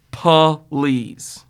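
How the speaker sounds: loud, drawn-out exasperated please. Shown as a demonstration. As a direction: scoff it like an eye-roll. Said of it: "As in please".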